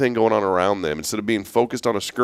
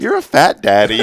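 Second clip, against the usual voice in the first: laughing quote; 4 dB louder than usual. Retold or imitated. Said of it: "she goes".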